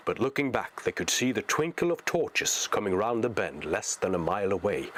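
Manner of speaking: ordinary delivery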